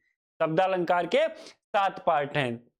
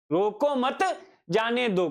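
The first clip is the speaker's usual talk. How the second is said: "रोको मत" joined, a gap, then "जाने दो"; group it as "रोको मत, जाने दो"; pause after मत; slower than usual. Shown as a demonstration. Teacher showing the opposite meaning, break after "मत". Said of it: "कंठ ध्वनि के कारण".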